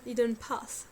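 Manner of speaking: Hidden Path in a deliberate English accent; lightly showy English accent; English accent again. Shown as a demonstration. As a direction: repeat the title with an English accent. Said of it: "vous avez vu mon superbe accent".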